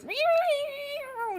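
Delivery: high, loud cat yowls; yowling cat imitation. Retelling the stepped-on cat, yowling high and loud. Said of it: "all you hear is".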